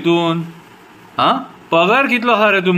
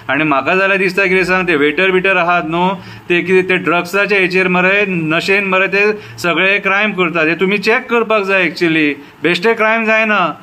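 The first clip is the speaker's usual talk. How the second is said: usual speaking voice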